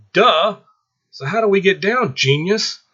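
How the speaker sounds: high, loud sarcastic jab; exasperated scoff. Acted. Lisa mocking Michael's obvious answer, loud sarcastic scoff. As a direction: roll your eyes at him out loud.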